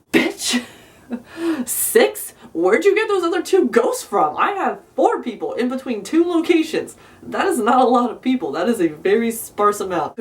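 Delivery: high, indignant disbelief at the boss; sassy high-pitched comeback; incredulous retort. Retold or imitated. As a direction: scoff at the numbers, pitch up.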